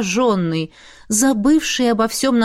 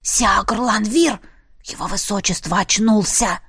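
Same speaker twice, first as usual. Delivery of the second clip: whisper yelling. Acted. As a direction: call out in a stage whisper. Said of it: "громким шёпотом позвал".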